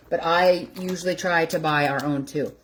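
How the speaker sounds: ordinary talk, nothing marked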